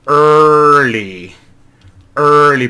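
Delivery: "early" starting straight on the r, no vowel; r-colored vowel. Shown as a demonstration. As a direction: hit "early" on the r with no vowel first. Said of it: "no es vocal".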